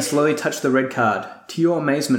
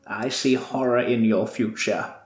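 ominous warning. Acted. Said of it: "she warns".

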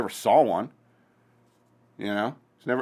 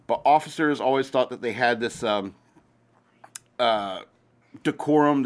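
normal talking voice

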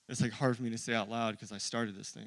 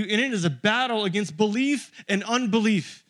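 louder, higher, driving pulpit voice; full-force preaching; 8 semitones higher, 11 dB louder than usual. Acted. Pastor preaching to his church at full force. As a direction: build it up and preach it hard.

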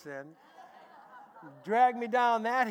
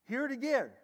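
normal talking voice

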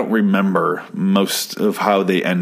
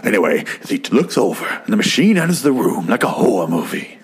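Day-Lewis voice narration. Shown as a demonstration. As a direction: keep the brooding movie voice going for the recap. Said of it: "I kinda wanna do the rest of the show like it".

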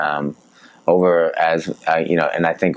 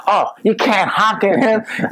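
much higher, slow whiny mimic of a scolding passenger; mocking ex-girlfriend; slower than usual. Ex-girlfriend scolds him for honking, mocked high and slow. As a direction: squeak it out in a mock scold. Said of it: "and I start honking".

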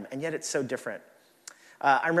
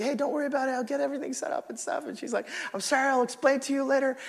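wife's breezy reply; 10 semitones higher, faster than usual. Retold or imitated.

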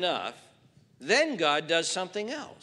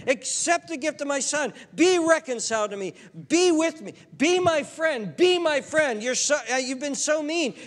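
God's voice, higher and louder, begging the listener; earnest, rising plea of God to the sinner; pleading God; 8 semitones higher, 5 dB louder than usual. Acted. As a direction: beg like a father whose love keeps being refused. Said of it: "what is God pleading and imploring".

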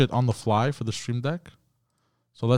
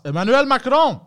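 mock announcer yell; 12 semitones higher, 7 dB louder than usual. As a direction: belt out the name like a hype announcer. Announces a name with exaggerated gusto.

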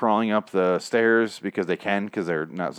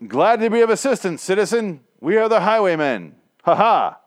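high-pitched mock-heroic declaration; grandly self-important, with a stagey laugh; pompous would-be heroes; 10 semitones higher, 4 dB louder than usual. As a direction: proclaim it like a hammy caped savior. Mocking pompous heroes' greeting.

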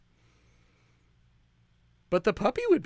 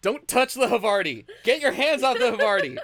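higher, playful mock anger; guard the cheese in jest; mock scolding; 8 semitones higher, 4 dB louder than usual. Joining friend's bit, playful mock protective scolding.

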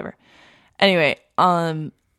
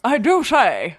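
put-on high, prim old lady voice; a hint of a genteel old lady; Katharine Hepburn; 6 semitones higher, 5 dB louder than usual. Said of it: "It's very Katharine Hepburn".

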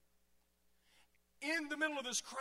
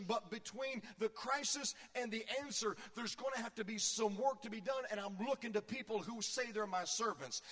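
ordinary delivery

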